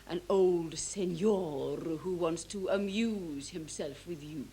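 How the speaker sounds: low, slow and lingering on the words; drawn-out insinuation. Acted. Stepdaughter recounting the madam's suggestion, slow insinuation.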